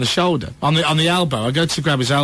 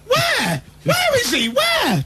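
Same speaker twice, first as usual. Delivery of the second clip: much higher, eager darting questions; Sophie's mum, excited; much slower than usual. Retold or imitated. Mum eagerly asking her daughter where the pap is, high and excited. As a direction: mimic a giddy mum hunting for the photographer.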